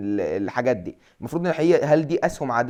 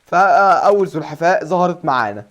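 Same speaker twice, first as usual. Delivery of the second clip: excited announcement; 4 semitones higher, 6 dB louder than usual. Real, not acted. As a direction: show off the first pet proudly. First tamed turtle shown. (بفخر وحماس)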